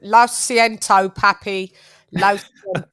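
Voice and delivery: put-on American accent Spanish, slow; Spanish said in an American gringo accent. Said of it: "I can say it in my gringo accent".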